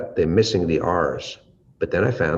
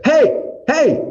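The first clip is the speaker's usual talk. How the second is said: loud high call; 8 dB louder than usual. Shown as a demonstration. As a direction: shout "Hey, hey" high and loud across a market. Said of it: "with a lot more tone, a lot more volume".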